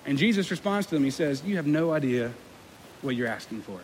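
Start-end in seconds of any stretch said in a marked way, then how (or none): 1.4-3.8 low, subdued answer trailing away; quiet grave reply. Acted. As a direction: say it softly and soberly, dropping off. Jesus's answer, first take, understated.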